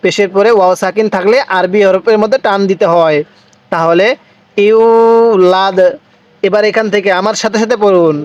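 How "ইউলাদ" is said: ইউলাদ with the u stretched long. Shown as a demonstration. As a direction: draw out the vowel in ইউলাদ slowly. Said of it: "এই ইয়ায়ের মধ্যে টান হবে".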